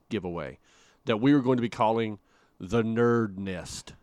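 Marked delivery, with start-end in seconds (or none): 2.6-3.9 very slow, deliberate name reveal; drawn-out reveal. Pretend. Host unveiling the new giveaway name, drawn-out. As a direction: stretch out each word like an unveiling.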